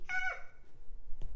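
a sudden high, sharp cry of "Ah!"; sample shout. Shown as a demonstration. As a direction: let out a short shout of praise. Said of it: "your shout might be".

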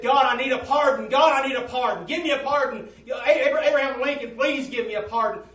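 high, loud, desperate begging; desperate plea. Retold or imitated. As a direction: cry out to God for mercy. Preacher voices pleading sinner.

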